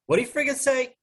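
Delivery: put-on high-pitched Jersey accent; Michael. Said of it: "gonna try to do some Jersey accents".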